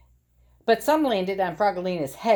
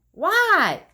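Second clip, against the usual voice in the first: loud high what; 12 semitones higher, 7 dB louder than usual. Acted. Froggy answers Dad.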